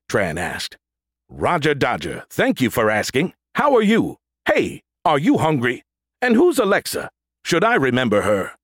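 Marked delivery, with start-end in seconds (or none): 1.3-8.6 robot voice. A narrator's reading. Erratic android, squeaky questions.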